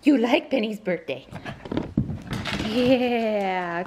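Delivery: ordinary talk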